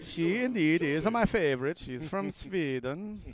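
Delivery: a light put-on Swedish accent, slowing on Sweden; mock Swedish accent. Joking about a favourite player, mock Swedish accent. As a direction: tease your co-host in a hammed-up Swedish accent. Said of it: "I'm doing this accent".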